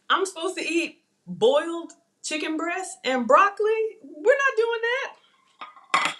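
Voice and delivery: high, slow mock outrage; mock indignation. Real, not acted. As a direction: refuse it with playful indignation. Mock outrage at diet food.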